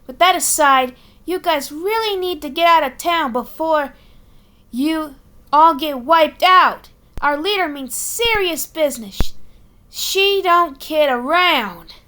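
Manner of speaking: high, serious Boston warning; Boston accent. Acted. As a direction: warn them gravely, Boston style. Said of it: "Boston accent".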